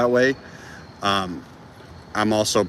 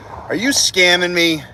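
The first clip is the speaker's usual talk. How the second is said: slow, high, loud accusing question; playful demand, drawn out; mock suspicion; 8 semitones higher, 8 dB louder, slower than usual. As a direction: tease the viewer like you caught them.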